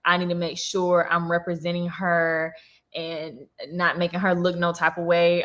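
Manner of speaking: ordinary delivery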